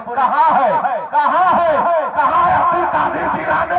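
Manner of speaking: angry calling out. Acted. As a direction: call out loud and high, demanding him. Acted grandfather calling out for boy.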